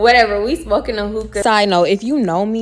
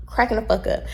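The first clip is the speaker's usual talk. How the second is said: ordinary talk, nothing marked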